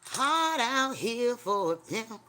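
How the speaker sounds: half-sung, stretched words; slow drawled quote. Pretend. Slow quoted song line.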